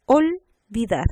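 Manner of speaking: olvidar slow, OL held apart; first syllable separated. Shown as a demonstration. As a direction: say OL, pause, then VIDAR. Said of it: "Vocal, consonante".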